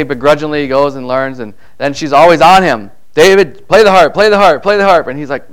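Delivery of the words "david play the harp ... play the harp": higher, louder nagging mom; a mock mother nagging her boy. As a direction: nag the kid to play the harp. Mom nagging David repeatedly.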